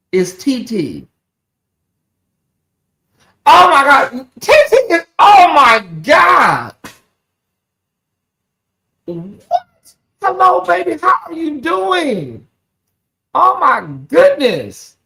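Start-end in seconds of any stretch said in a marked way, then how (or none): 3.5-6.7 high, loud squeal of astonishment; delighted shock. Real, not acted. Host recognising an old friend in the chat, delighted shock. 9.0-15.0 high, drawn-out cry of delight; overjoyed greeting. Real, not acted. Host greeting a long-lost friend, overjoyed.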